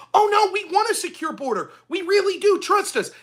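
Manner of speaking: high, sing-song mock of fake sincerity; mocking whine. Mocking Democrats promising a secure border, high sneering mock. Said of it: "over and over".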